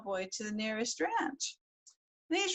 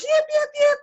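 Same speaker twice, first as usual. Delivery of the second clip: high loud yipping like a coyote; coyote yips; 9 dB louder than usual. A narrator's reading. Bill yipping to prove he is a coyote. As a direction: yip wildly like a coyote pup. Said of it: "he danced around on all fours".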